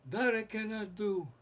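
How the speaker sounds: imitated crime boss, high measured refusal; a mob don's high, held refusal; Godfather. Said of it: "the Godfather goes".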